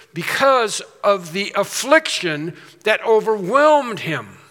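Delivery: full-force sermon climax; preaching at full force. Acted. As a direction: drive it home from the pulpit, rising. Preaches Peter's bitter weeping.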